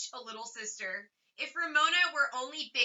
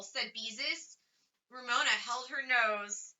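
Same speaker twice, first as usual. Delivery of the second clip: usual speaking voice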